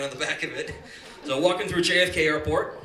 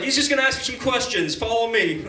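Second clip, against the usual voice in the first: officer; 5 semitones higher, 4 dB louder than usual. Retold or imitated. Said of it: "And he's like".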